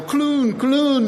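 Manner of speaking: put-on high-pitched French kids' call; French accent. French kids crowding round the goofball rider, French-accented calls. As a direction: call it out like excited French children. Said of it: "like clown".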